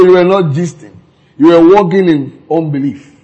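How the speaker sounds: usual speaking voice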